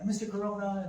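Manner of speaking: put-on kids calling out his name. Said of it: "They're like".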